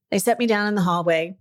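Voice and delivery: ordinary delivery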